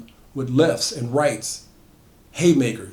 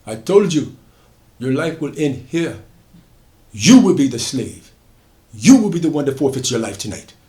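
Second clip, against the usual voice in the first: much louder, proud proclamation; triumphant declaration; 3 semitones higher, 7 dB louder than usual. Acted. Solomon declaring to Drummond, loud and triumphant. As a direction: proclaim your freedom to the catcher.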